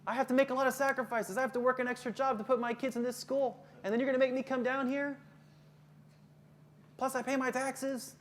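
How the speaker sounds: high-pitched, aggrieved parent complaint; indignant parent. Acted. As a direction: act out a put-upon parent protesting. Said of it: "They're gonna say that".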